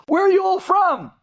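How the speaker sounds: put-on high-pitched, punched-up Brooklyn accent. Host asks his dinner guests where they're from, Brooklyn accent. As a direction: imitate a Brooklyn local calling it out high. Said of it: "He was talking with a Brooklyn accent".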